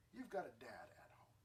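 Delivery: unvoiced hushed aside; whisper. Real, not acted. Preacher whispering to the girls about their dads. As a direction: whisper it like a secret.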